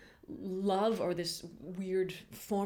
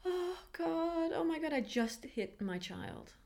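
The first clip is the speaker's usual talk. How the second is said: horrified gasp; 11 semitones higher, slower than usual. Retold or imitated. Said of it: "I was like".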